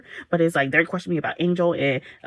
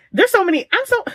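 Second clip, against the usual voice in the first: exasperated; 5 dB louder than usual. Real, not acted. Upset at Emmy's choices.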